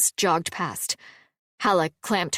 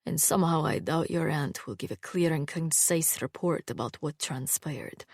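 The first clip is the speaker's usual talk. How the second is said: dry swordsman voice; 3 semitones lower, 5 dB quieter than usual. A narrator's reading. Sarkis's voice, dry and low.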